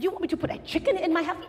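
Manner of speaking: can't believe the advice; incredulous. Acted. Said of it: "He said".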